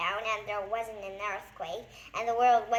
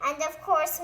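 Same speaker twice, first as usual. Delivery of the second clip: ordinary delivery, nothing marked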